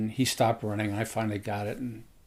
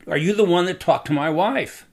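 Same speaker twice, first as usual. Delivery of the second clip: retold shout; 6 semitones higher, 8 dB louder than usual. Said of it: "He just kind of shouted at me".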